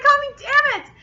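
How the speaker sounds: high, exasperated scolding; loud whiny blame; mad outburst. Retold or imitated. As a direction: re-enact your furious reaction, high-pitched. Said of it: "I was so mad at Cam".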